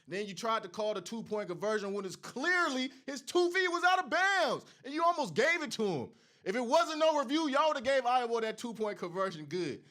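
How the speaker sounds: loud, high, furious complaint about the call; rising outrage at the referees; angry rant. Real, not acted. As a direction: fan yelling at the referees' bad call. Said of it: "Let me calm down".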